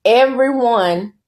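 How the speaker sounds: normal talking voice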